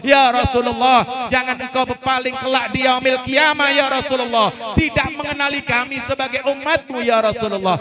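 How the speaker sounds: loud, fervent supplication with each ya Allah called out; fervent prayer. Real, not acted. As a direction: lead the congregation in pleading prayer. Leads closing prayer. (doa penutup yang lantang dan khusyuk)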